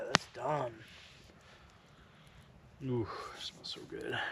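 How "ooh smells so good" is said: hushed savouring whisper. Real, not acted.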